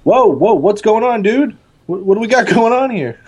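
high, alarmed call across the road; in-character call to Mike. Acted. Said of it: "I'm gonna be like".

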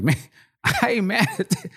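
a grinning hustler who admires the move; hustler voice laughing. Retold or imitated. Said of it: "the hustler in me was like".

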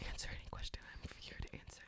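teasing ASMR whisper. Real, not acted. Whispering an offer to answer the host's questions. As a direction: whisper it invitingly into the mic. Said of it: "If you whisper one more time".

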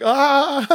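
mock scream. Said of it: "the hands are just like".

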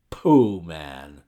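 loud, scornful pooh; dismissive. A narrator's reading. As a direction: wave the idea away loudly. Pinner dismisses the clerk's job.

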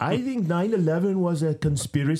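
high, loud mock African accent. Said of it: "in his African accent".

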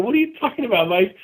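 high-pitched incredulous question; retold disbelief. Agent to neighbor who is quitting his job, disbelieving.

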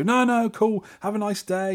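high, light imitation; breezy stranger. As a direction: act out cheerfully waving thanks away. Acting out generous family waving thanks away.

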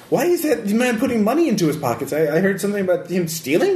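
a hint of a fussing priest; confused priest. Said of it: "and he saw".

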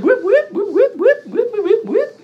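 high, whooping siren noises; imitated police siren. Cop car shows up after deer crash, imitated siren whoops. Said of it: "That's how he was talking at first".

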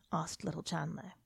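plain reading voice

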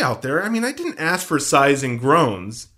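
ordinary talk, nothing marked

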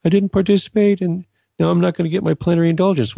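mock the moping of someone who missed out. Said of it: "people who are bummed out about".

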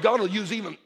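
ordinary talk, nothing marked

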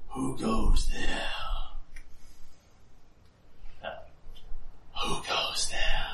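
slow, breathy creature voice asking from the darkness; a hint of a lurking shadow creature; breathless deep voice. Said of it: "A breathless but deep voice".

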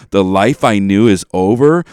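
swelling loud on the final line; over-the-top tragic pronouncement of "over"; dramatic lyric recitation. Retold or imitated. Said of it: "she starts saying this".

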